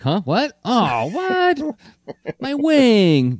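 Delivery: very high, confused yelp; high-pitched clueless surprise; startled Skywarp. Acted. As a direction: play baffled, dawning dismay in a squeaky pitch. Acting Skywarp noticing the damage.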